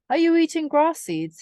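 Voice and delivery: high scornful mouse sneer. A narrator's reading. Hickory scorning the mice for eating seeds. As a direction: sneer down at them. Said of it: "he said scornfully".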